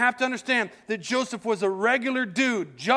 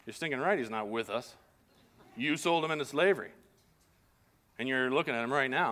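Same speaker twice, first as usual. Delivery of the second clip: sarcastic aside; 7 semitones lower, 6 dB quieter than usual. Retold or imitated. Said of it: "I'm sure Joseph is like".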